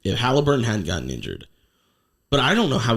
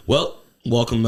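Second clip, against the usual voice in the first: usual speaking voice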